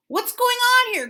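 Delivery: loud high demand; angry shout. A narrator's reading. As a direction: ringmaster shouting. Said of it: "cried the ringmaster".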